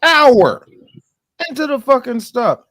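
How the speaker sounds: high, loud incredulous outburst; outraged disbelief. Real, not acted. Outraged at one-hour stop.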